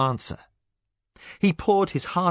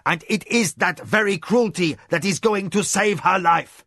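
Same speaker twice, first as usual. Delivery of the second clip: shouting; 7 semitones higher, 3 dB louder than usual. A narrator's reading. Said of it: "he shouted back".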